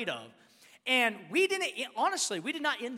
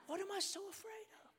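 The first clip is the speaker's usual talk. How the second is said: high, very soft wondering; hushed self-questioning; 12 semitones higher, 12 dB quieter than usual. Retold or imitated. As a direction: ask yourself softly, pitch lifted. Hushed self-questioning after revelation.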